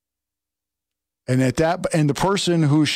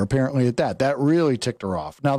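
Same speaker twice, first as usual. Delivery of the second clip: normal talking voice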